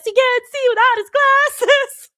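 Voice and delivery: high, loud, slow mock-tearful plea; imitating a crying girl. Streamer quoting the sad My Girl line, loud mock sobbing. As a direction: wail the line like the weeping girl. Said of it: "every time she says".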